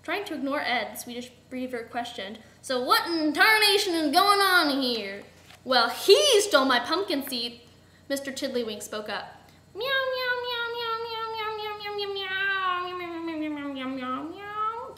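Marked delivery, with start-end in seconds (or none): 2.6-5.2 slow, loud, higher demand; hammy indignant questioning; blustering beaver. A narrator's reading. 5.6-7.6 slow, loud accusation; indignant, stretched-out grievance; aggrieved complaint. A narrator's reading. Aggrieved villain explains herself. 9.8-15.0 long high meows gliding downward; running cat chatter, sinking then swooping up; cat meowing. A narrator's reading. Gary the cat informs them.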